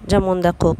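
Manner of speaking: ordinary delivery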